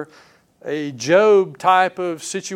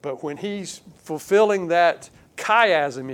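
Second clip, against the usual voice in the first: ordinary delivery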